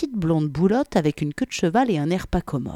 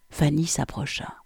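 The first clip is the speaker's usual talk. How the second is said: usual speaking voice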